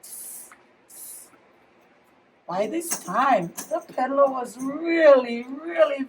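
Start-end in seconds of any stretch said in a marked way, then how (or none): 0.0-1.3 whispered monkey tisking, mocking him back. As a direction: tsk at him like cheeky monkeys. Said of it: "the monkeys only stamped their feet".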